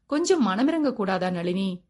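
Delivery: ordinary delivery, nothing marked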